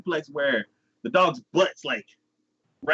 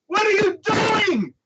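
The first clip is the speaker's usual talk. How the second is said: loud, very high, slow shout of disbelief; hammed-up shocked shriek; mock horrified yell; 4 dB louder, slower than usual. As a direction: scream it at the screen, appalled. Narrator yells in disbelief.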